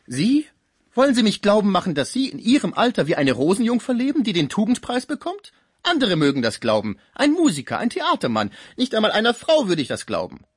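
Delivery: loud, high-pitched mocking disbelief; incredulous concierge. Concierge refuses to believe he was unloved, loud incredulous scoffing. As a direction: scoff at him in theatrical outrage.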